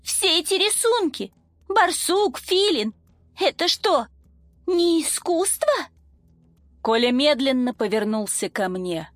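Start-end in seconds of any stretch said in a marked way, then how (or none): 0.0-5.7 high, stunned voice full of dread; horrified. A narrator's reading. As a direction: realise the horror, voice rising. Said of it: "холодный, отрезвляющий ужас".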